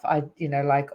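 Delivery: ordinary talk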